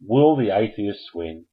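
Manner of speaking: ordinary talk, nothing marked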